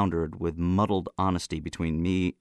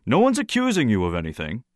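raised, slightly odd tone; 5 semitones higher, 4 dB louder than usual. A narrator's reading. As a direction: say it oddly, pitched up. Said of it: "in an odd tone".

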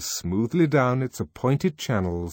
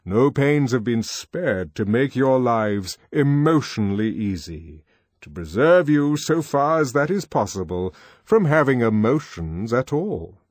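stately controller; 4 semitones higher, 6 dB louder than usual. Acted. Controller lecturing the boys.

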